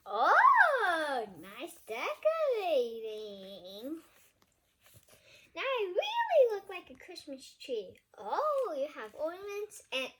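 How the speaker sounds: hammed-up outrage at being decorated; loud indignant character. Larry the monster complaining loudly to the reader about decorations.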